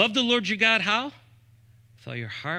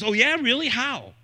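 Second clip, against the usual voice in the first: sarcastic challenge; 6 semitones higher than usual. Acted. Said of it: "the response is".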